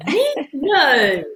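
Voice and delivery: loud, high, drawn-out protest; hammed-up disbelief at being called stubborn; mock denial. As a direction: play innocent, loudly exaggerated. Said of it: "described me as stubborn. I go".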